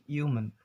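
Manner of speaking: "human" as yoo-mun; American silent H. Shown as a demonstration. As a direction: start "human" on the y sound, h left out. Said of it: "they might be pronounce this word like".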